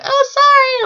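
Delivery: very high, loud squeaked sorry; mock apology. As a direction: squeal a hurried retold apology. Hasty apology before driving off.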